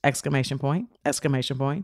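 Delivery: low, soft aside; hushed, matter-of-fact dictation; punctuation read aloud. Retold or imitated. Reads out punctuation of own message.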